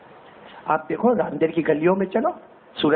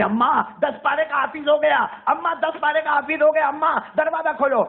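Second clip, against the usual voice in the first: excited calling; 9 semitones higher than usual. Retold or imitated. Boy shouts to his mother that he has learned ten parts. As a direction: cry out the good news through the door.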